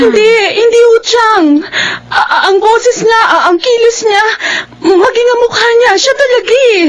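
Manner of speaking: high, crying voice, words breaking; tearful distress. Acted. Niece tells her aunt she saw her ex, crying. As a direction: fight through the sobs to get the words out.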